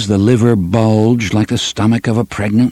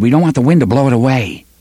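hurried nag; 4 semitones higher, much faster than usual. Acted. Cuthbert nagging Alain.

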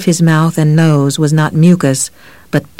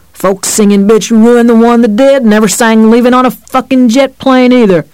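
higher, louder sneering cop; bitter cop rant; 6 semitones higher, 5 dB louder than usual. A narrator's reading. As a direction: spit it out with contempt. Cop rants about singer.

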